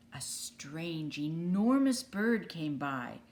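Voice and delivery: ordinary delivery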